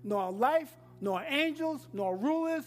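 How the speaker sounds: full-voiced call and response; preaching at full force. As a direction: preach it with rising fire.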